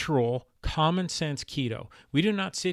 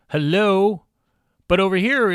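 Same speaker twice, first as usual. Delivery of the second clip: loud, higher, indignant hello; exasperated, raised-voice disbelief; incredulous callout; 4 semitones higher, 9 dB louder than usual. Real, not acted. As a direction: call out an obvious contradiction. Incredulous at the contradicting label claim.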